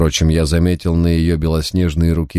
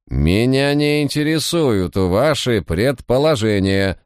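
high, slow, imperious interruption; curt, cutting; 7 semitones higher, slower than usual. A narrator's reading. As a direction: cut the official off in a high voice. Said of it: "отрезала дама". (резко, отрезая)

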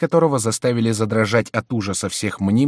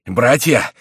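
loud, raised alarm call, narrated; breathless cry; 4 semitones higher, 7 dB louder than usual. A narrator's reading. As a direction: cry out to your brothers in alarm. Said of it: "вскричал он, запыхавшись". (тревожный возглас)

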